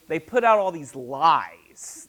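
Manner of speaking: ordinary talk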